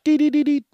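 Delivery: high, loud beeping sounds; high mock scanner beeps. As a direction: chirp like a hand scanner. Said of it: "the back of your hand".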